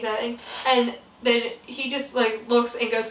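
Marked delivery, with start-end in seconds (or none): none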